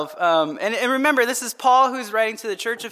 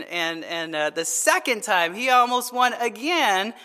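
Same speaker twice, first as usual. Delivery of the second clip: ordinary talk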